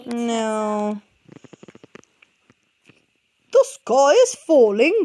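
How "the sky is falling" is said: louder, slow turkey voice; turkey joins in, gobbling. A narrator's reading. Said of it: "gobbled Turkey Lurkey".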